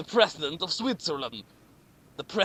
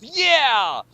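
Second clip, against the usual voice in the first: cheer; 6 semitones higher, 6 dB louder than usual. A narrator's reading. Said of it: "jumped into the air and smiled and went".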